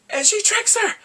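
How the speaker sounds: high, loud indignant cry; worked-up storyteller outburst; outraged exclamation. Real, not acted. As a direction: shout it in scandalized disbelief. Outraged recap of a trick.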